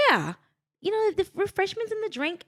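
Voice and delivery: put-on high, chirpy English accent. London friend explains watering to her, English accent. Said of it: "is that your English accent?".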